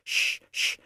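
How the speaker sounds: unvoiced "shh, shh"; two short warning hushes; hushing. A narrator's reading. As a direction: shush a friend urgently. Hushing as the boss approaches.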